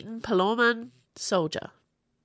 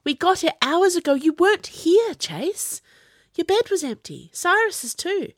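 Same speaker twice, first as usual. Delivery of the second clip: urgent and upset; 13 semitones higher, 6 dB louder than usual. A narrator's reading. Upset girl explains urgently.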